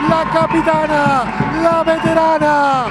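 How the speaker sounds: high, loud, slow triumphant commentary; excited goal call. Real, not acted. Commentator goal call after penalty.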